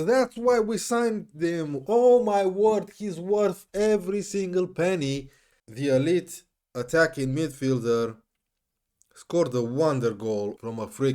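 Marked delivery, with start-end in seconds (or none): 0.1-5.3 delighted. Real, not acted. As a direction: savor every word of it. Gushing after the goal.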